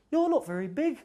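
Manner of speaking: very high squeaky mouse. Acted. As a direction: say it like a tiny mouse.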